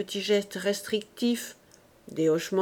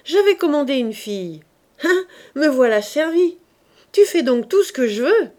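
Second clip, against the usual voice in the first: high, loud jesting husband; laughing tease to a tired wife; joking cheer; 5 semitones higher, 7 dB louder than usual. A narrator's reading. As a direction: cheer her up with a loud joke. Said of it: "blaguant pour la remettre".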